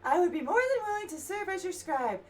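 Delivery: character's normal voice. Acted. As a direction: voice the grateful scholar up high. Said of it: "I'm gonna kill my voice".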